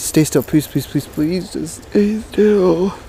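begging that rises into a drawn-out whine; pleading. Real, not acted. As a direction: beg the fish, stretching the last words. Begging fish to hold still.

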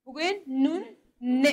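نون drawn out, then ن held as a nasal n; slow letter drill. Shown as a demonstration. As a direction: call out the letter name then its sound. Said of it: "زبان به کام میچسبه".

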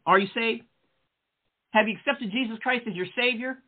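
ordinary delivery

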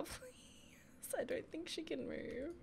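exasperated whisper. Real, not acted. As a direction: hush it in disbelief.

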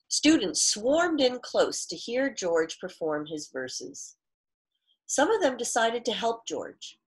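clear, fuller read-aloud storytelling voice; story narration. A narrator's reading. Teacher reads picture book aloud.

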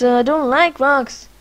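mock whine about rocks. Repeated complaint louder.

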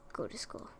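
breathy whispered order. Real, not acted. Gamer whispering at her sims to go to school. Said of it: "I'd like to see you guys all go to school".

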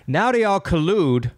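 ordinary talk

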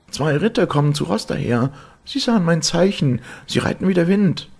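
very high, loud woman's voice; high-pitched urgent announcement; excited calling out. A narrator's reading. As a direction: shout the hopeful news down from the tower. Sister announces rescuers from tower.

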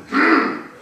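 loud, indignant grunt; short, forceful harrumph; Sultan's hmph. A narrator's reading. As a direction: huff it out, displeased. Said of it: "said the Sultan".

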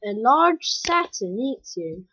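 normal talking voice

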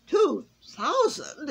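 high, loud, slow disbelieving cry; shocked exclaim. A narrator's reading. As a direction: gasp out the number in shock. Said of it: "exclaimed Sir Saint Nicholas".

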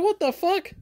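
high incredulous squeal; mock shocked outburst; disbelief. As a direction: react like you can't believe your eyes. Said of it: "I'm like".

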